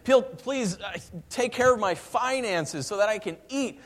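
preacher voicing a desperate plea; desperate prayer. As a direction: beg God on your knees. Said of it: "you're crying and praying".

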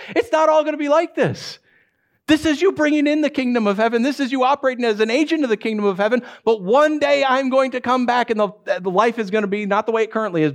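high, driving, emphatic promise; impassioned preaching. Acted. Jesus reassuring fearful followers of his return, impassioned preaching. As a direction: proclaim it urgently, pitched well up.

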